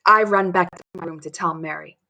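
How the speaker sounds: plain reading voice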